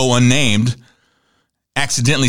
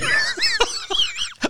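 shrieking burst of laughter; laughing hard at dad's retort; 5 dB quieter than usual. Real, not acted. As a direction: howl with laughter. Cracking up at father's line.

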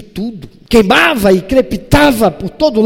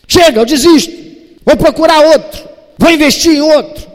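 loud, higher exasperated outburst; fed-up quitting; 8 semitones higher, 6 dB louder than usual. Retold or imitated. Someone giving up on Elias, loud and exasperated. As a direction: slam the door on him.

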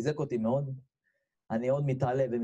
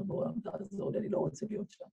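high, soft, voiceless plea; crying out to God; 8 semitones higher, 6 dB quieter than usual. Acted. Sinner begging the Creator, high hushed pleading cry. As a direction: imitate a strained, hushed cry for help. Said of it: "אבל אתה צועק".

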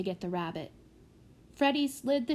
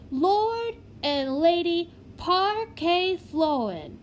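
slow, grand announcement; 8 semitones higher, 5 dB louder, slower than usual. A narrator's reading. Prodigy announces names.

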